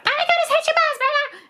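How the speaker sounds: put-on excited child. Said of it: "she's like".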